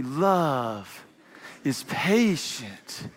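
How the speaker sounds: lingering, precious calligraphy reading. Shown as a demonstration. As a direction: paint each word like swooping script. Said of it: "we write it in calligraphy".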